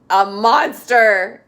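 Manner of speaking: slow, loud, solemn. Acted. Angus answers the policeman solemnly and slowly. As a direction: say it gravely and slowly. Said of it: "Angus said solemnly".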